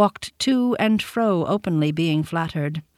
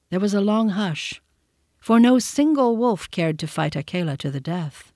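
ordinary delivery

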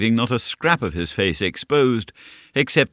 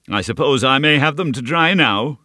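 firm, a bit louder and higher; firm, brooking no denial; 6 semitones higher, 4 dB louder than usual. A narrator's reading. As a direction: allow no argument. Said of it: "in a voice that brooked no denial".

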